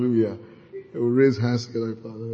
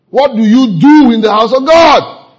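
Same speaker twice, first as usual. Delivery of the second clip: preaching; 8 semitones higher, 11 dB louder than usual. Acted. Preacher rebuking congregation loudly.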